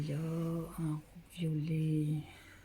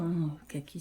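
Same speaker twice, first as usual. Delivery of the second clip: ordinary talk, nothing marked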